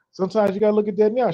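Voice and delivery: normal talking voice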